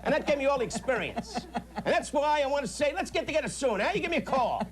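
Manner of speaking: a tough-guy dockworker act; brash street talk, in a high voice; cocky wise guy, pitched high. Acted. Playing a cocky wise guy to the caller Eddie.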